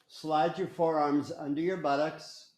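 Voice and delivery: ordinary talk, nothing marked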